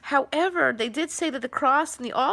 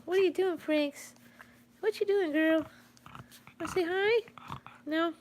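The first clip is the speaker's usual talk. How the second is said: high, softer sing-song puppy talk; 7 semitones higher, 5 dB quieter than usual. Real, not acted. Owner coos at her restless dog, high and soft.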